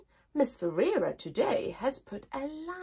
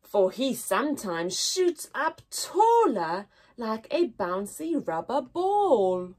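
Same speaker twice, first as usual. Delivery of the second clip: put-on poem reading to children. Teacher reading the poem aloud to her class. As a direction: read it gently with long pauses.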